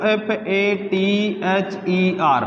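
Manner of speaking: spelling aloud slowly for students. Shown as a demonstration. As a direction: spell F A T H E R letter by letter, very slowly. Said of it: "मैं यहाँ एक spelling लिख रहा हूँ".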